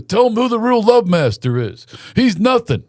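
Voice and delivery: high-pitched imitation of a hustling dad; boastful father. Dad recruiting fans for mule rides, boastful pitch. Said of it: "He's over here recruiting people for mule rides".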